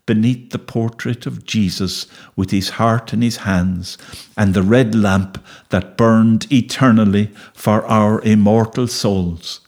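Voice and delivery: usual speaking voice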